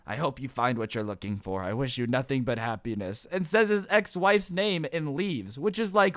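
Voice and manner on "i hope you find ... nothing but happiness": low, soft, heavy-hearted goodbye; mock-solemn farewell. Old man bids his imagined ex-wife farewell, solemnly. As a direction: drop low and quiet for a tragic parting.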